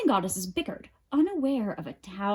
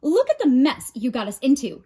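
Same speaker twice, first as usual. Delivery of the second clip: louder, higher angry yell; 4 semitones higher, 7 dB louder, faster than usual. A narrator's reading. Hestia blames her siblings, a louder angry yell. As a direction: blame them hotly. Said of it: "yelled Hestia".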